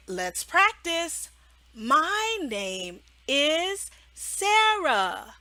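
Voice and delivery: "My name is Sarah" stretched very slowly; slow modeling. Shown as a demonstration. Teacher modeling a sentence for the child, very slow. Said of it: "And you can say".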